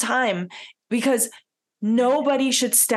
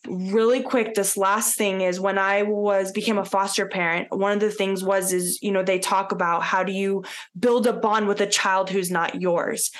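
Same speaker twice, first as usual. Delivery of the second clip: usual speaking voice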